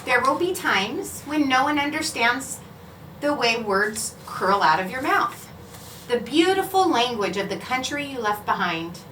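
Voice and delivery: plain reading voice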